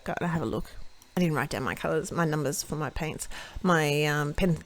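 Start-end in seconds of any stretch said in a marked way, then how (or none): none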